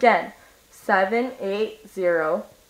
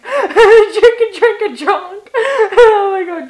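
high, loud words through laughter; real giggling fit, pitched way up; laughing hard; 9 semitones higher, 11 dB louder than usual. Real, not acted. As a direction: crack up while chanting it. Cracking up at own wordplay.

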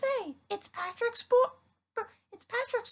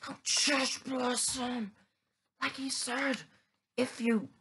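deep voice; 10 semitones lower, 3 dB quieter than usual. Acted. A different character voice.

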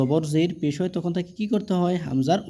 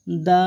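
দা held long and level; drawn-out vowel; 3 semitones higher, 4 dB louder than usual. Shown as a demonstration. Quran teacher showing one-alif length, দা drawn out. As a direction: draw দা right out. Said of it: "এগুলো টেনে পড়তে হবে একালিফ পরিমাণ".

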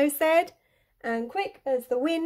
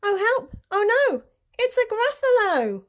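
high-pitched storybook panic; alarmed cry; 11 semitones higher, 3 dB louder than usual. A narrator's reading. Mouse suddenly meets the Gruffalo, panicked. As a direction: cry out for help, voice shooting up.

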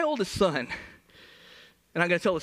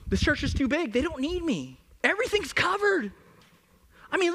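higher, whiny excuse voice; excuse-making churchgoer; 6 semitones higher than usual. Retold or imitated. A churchgoer listing excuses not to serve, whiny. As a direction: rattle off excuses defensively.

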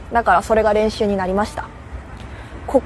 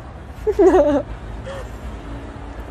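soft breathy laugh; 8 semitones higher, 5 dB quieter than usual. Real, not acted. Laughs at own translation.

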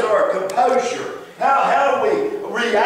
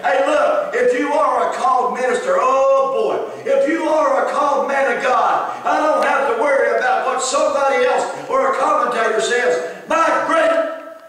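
higher, louder, driving sermon voice; full-force preaching; 5 semitones higher, 3 dB louder than usual. Acted. Preacher rousing his congregation, raised and fervent.

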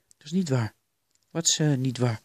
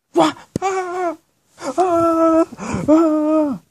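high, sustained "aah" screams; piercing high terror scream; loud screaming; 13 semitones higher, 12 dB louder, much slower than usual. Acted. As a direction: scream in bursts at full volume. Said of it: "Niet zo hard schreeuw".

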